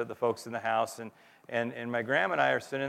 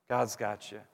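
quieter and lower, "God's got you" said gently; soft assurance; 3 semitones lower, 7 dB quieter than usual. Acted. Preacher comforting frightened listeners, softly. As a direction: ease off and let "God's got you" land tenderly.

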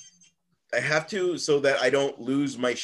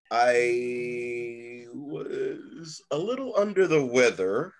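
usual speaking voice

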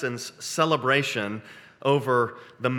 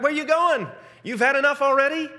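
high, loud, mocking jeer at the sea; taunting; 12 semitones higher, 5 dB louder than usual. Psalmist taunting the fleeing sea, high loud mockery. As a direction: tease the sea like a cocky winner. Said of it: "he starts taunting".

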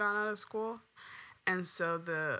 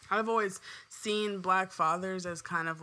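ordinary delivery

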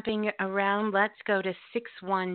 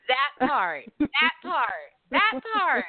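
rising, louder, more hyped each time; excited; 4 semitones higher, 4 dB louder, much slower than usual. Real, not acted. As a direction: build the hype with every repeat. Host hyped over the poem.

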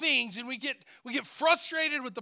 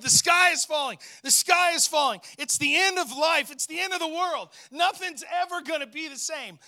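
shouted panic; 4 semitones higher than usual. Acted. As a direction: yell it like the world is ending. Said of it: "He shouted".

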